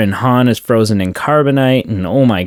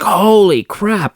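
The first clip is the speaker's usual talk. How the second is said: much higher, slow astonished exclaim; amazed viewer; 11 semitones higher, slower than usual. Retold or imitated. Viewer reacting to the twins reveal, higher slow astonishment. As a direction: stretch it out in awe. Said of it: "You're just like".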